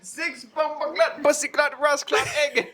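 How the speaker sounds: very high, drawn-out mock Jamaican; mock Jamaican accent. Shown as a demonstration. Friend joining the Jamaican bit, high mock Jamaican accent. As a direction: squeal it in exaggerated Jamaican patois.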